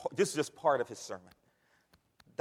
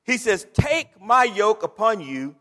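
loud, high call and response; full-force preaching; 8 semitones higher, 12 dB louder than usual. Acted. Pastor whipping up the congregation, loud high preaching. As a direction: shout it like the church is answering.

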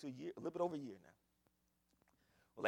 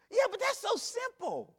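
mock believer; 9 dB louder than usual. Preacher voicing doubting believer.

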